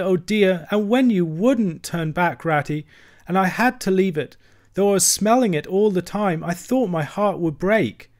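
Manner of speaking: a small creature weeping for his lost home; sobbing lament. A narrator's reading. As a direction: plead through sobs, voice climbing. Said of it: "he sobbed forth at last, brokenly".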